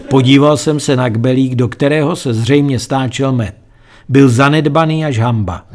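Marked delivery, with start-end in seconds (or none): none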